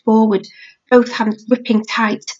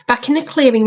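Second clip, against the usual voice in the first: usual speaking voice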